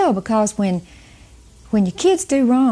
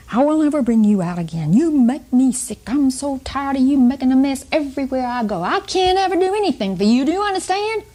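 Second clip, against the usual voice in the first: scolding mother's rant, climbing higher; mock screaming; 4 semitones higher than usual. Retelling a mother's outburst at her little girl, mock screaming. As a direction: imitate a fed-up mother losing it, pitch rising. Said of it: "started screaming at her".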